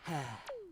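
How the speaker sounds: sigh. A narrator's reading. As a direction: let out a tired big-sister sigh. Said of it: "She sighed".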